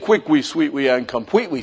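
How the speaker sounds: ordinary talk, nothing marked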